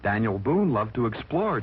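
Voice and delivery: usual speaking voice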